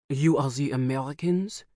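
put-on movie-spy man with a heavy French accent, rising on you; a French-accented stranger sizing up the Americans. A narrator's reading. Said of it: "he said in a heavy French accent".

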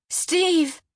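high call on the edge of hysteria; near-hysterical shout for her husband; ragged, sharp call. A narrator's reading. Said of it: "Her voice was ragged and sharp, pushing down the rising force of hysteria".

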